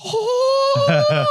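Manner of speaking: long, very high, loud "oh"; hammed-up starstruck cry; thrilled squeal. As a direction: mimic an overjoyed gasp rising to a squeal. Wife lit up on hearing accent.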